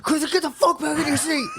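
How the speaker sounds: high, stern order; mock yell at dog. Owner scolds his dog to get back in its seat, high stern yell.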